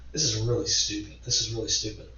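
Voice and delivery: low, soft self-talk; quiet, sinking realization, reenacted; muttering to himself. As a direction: mutter it under your breath, low.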